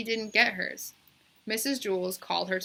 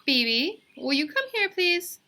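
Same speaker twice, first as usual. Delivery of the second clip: teacher calling; 9 semitones higher, 6 dB louder than usual. A narrator's reading. Teacher calls pupil.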